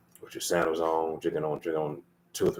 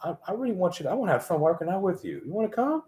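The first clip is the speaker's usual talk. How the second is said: sweet gentle ask; 9 semitones higher, 4 dB quieter than usual. Pretend. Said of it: "be like".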